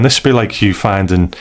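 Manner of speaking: normal talking voice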